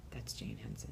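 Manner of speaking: urgent starstruck whisper; whispered tip-off at the festival table. Retold or imitated. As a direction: whisper it so she won't hear. John grabs her leg.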